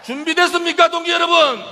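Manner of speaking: high, loud call to the crowd; roaring rallying speech. Real, not acted. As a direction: whip the crowd up with the question. Asks the crowd again, louder.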